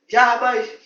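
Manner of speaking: loud commanding call; bossy shout. Acted. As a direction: bark for the servant. Boss shouting for his servant.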